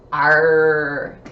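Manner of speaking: long, loud, low pirate "arr"; pirate sound. Shown as a demonstration. Teacher pointing out a phonogram by ear, pirate voice. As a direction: growl out the letters like a pirate. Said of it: "I hear a pirate".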